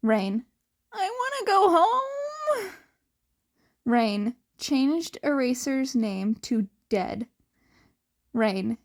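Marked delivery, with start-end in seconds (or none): 0.9-2.7 put-on sulky kid wanting to leave; a high, hammed-up whine; mock whining. Whiny chat message.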